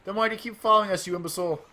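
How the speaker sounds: high, fast, loud retort; yelling back. Acted. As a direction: snap back at the enemy captain. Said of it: "Captain Allegra yelled back".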